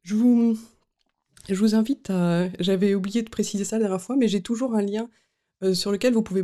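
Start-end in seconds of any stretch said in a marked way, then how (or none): 0.0-0.6 just after a sip of coffee; talking right after swallowing coffee; while sipping a drink. Real, not acted. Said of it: "je prends une gorgée de café".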